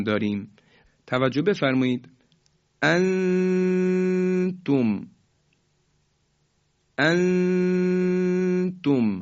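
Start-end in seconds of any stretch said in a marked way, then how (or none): 2.8-9.2 انتم with a held two-beat nasal hum on the hidden نون, very slow; انتم chanted slowly, nasal held; ikhfa with pause and length. Shown as a demonstration. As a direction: recite انتم stretching the ghunna. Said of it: "همراه با مکث و کشش".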